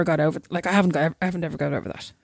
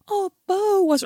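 high-pitched concerned cooing; imitated teacher; 11 semitones higher, slower than usual. Teacher checking on sobbing pupil, imitated high concerned voice. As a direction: pitch it up like fussing over a small child. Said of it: "his teacher comes down and goes".

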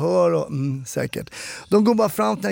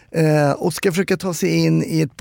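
ordinary talk, nothing marked

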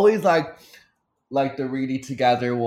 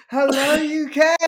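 high, drawn-out concert holler; big slow arena hello; mock stage greeting; 3 dB louder than usual. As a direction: mimic a star hailing the crowd. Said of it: "He's like".

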